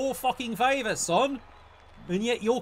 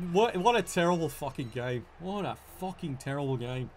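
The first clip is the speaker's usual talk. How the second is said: much lower, much softer defeated mutter; deflated despair; 10 semitones lower, 7 dB quieter than usual. Real, not acted. After the dice betray him, low defeated mutter. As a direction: sink into defeat.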